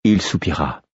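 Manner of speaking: ordinary delivery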